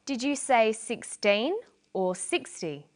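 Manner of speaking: put-on slow check question contrasting SIXTEEN on TEEN with SIXTY on SIX; slow, careful clarifying question, sixteen against sixty; stress key syllable. Shown as a demonstration. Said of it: "stress the key syllable".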